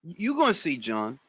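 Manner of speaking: soft, low-key teasing warning; quiet knowing taunt. Real, not acted. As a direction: drop the voice to a hush and tease him. Teasing a chat member.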